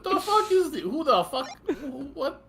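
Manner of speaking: high, indignant comeback, half laughing; mock outrage. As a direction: act offended and push the pitch up.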